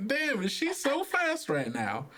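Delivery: ordinary delivery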